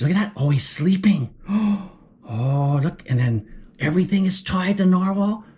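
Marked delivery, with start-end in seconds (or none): none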